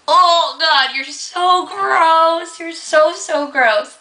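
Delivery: high, slow, drawn-out disgust. Retold or imitated. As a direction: stretch out gross like it makes you sick. Disgusted at the cockroach.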